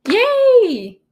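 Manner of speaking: a sudden, high, loud squeal of joy; excited cheer. Real, not acted. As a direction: cheer like you just got a treat. Cheering over coloring.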